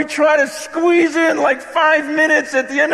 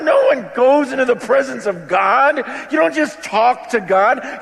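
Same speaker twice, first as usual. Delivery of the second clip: normal talking voice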